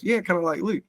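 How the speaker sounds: high, dry agreement. Retold or imitated. [name] humoring the uncle, high and dry. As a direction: humor him dryly. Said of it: "He goes".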